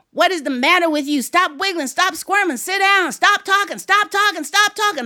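high, rapid-fire scolding; grandma scolding. Acted. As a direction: rattle off stacked commands as a fed-up grandma.

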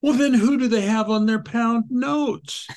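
put-on a little girl puzzling it out, hands on hips; puzzled little girl. Daughter demanding who is on pound notes, high child voice.

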